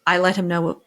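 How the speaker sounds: normal talking voice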